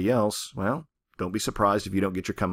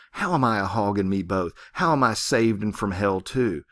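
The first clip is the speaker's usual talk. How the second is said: ordinary delivery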